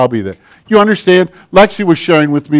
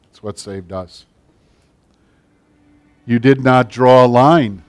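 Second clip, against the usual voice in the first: prayer; 2 semitones lower than usual. Real, not acted. Leads closing prayer over music.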